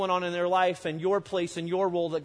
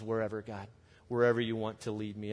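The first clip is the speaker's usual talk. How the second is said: prayerful surrender; 7 semitones lower, 4 dB quieter than usual. Pretend. Voicing a prayerful response to God.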